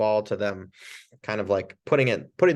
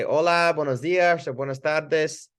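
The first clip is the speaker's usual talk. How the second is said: bright, higher greeting to a patient; friendly Spanish greeting; 5 semitones higher than usual. Shown as a demonstration. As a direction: greet them warmly in Spanish. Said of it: "I would say".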